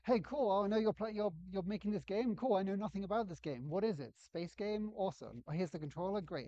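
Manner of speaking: high, loud, breezily eager newcomer act; hammed-up clueless player. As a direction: ham up an enthusiastic first-time player. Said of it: "he'd ham it up and be like".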